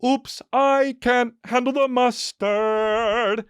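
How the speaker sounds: very high, very slow and drawn out; hammed-up helpless idiot dad; dumb cartoon dad. Cartoon dad fumbles the mustard, mock helpless dad voice. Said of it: "And the dad's like".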